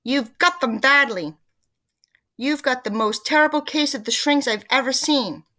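loud, high, emphatic diagnosis; crying out. A narrator's reading. Mister Twit frightening his wife about the shrinks, crying out. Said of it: "cried Mister Twit".

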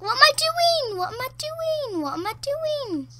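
very high repeated self-questioning; flustered squeal. Real, not acted. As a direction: squeak it out high, falling at each end. Flustered, stuck while playing.

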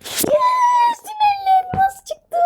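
high thrilled cry of joy; excited shout. Real, not acted. Gamer cheering a lucky diamond drop, high excited shout. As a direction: shriek it, then stretch the rest out slowly. Gets diamonds in Roblox game.

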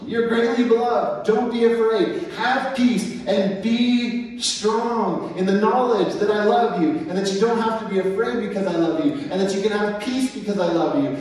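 high, loud preached comfort; impassioned reassurance. Acted. Angel to frightened Daniel, impassioned comfort. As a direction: pour reassurance over him.

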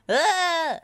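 high, loud yell of alarm; short storybook scream on falling; startled cry. A narrator's reading. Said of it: "cried Bob".

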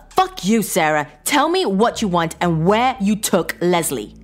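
loud, high-pitched furious demand; heated husband losing his patience; angry outburst. Acted. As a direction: cut in cursing and demand answers. Husband cuts in, cursing and demanding.

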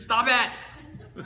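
high, loud comic yell; screamed command. Retold or imitated. Counselor repeating his only advice to the client, screamed command. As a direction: scream it abruptly, far above talking pitch. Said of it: "He screamed".

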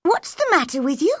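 crossly. A narrator's reading. Mary asks the sulking boy, cross and impatient. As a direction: snap the question out crossly. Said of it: "she asked crossly".

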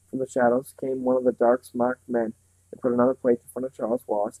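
ordinary delivery, nothing marked

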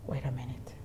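hushed, low near-whisper; quiet imitation of a preacher's command; imitating woman preacher. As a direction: halt everyone with a very soft word. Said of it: "You know the way she speaks".

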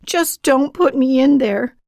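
loud, high-pitched plea; desperate begging. A narrator's reading. Boy begging bullies before the locker, desperate. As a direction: cry out, begging not to be shut in. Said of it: "he begged".

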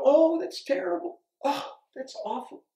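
high, mock-shocked "oh" exclamations; fading from high mock gasp to breathy; mock dismay. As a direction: ham up a gasping, fretful onlooker. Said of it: "he didn't go".

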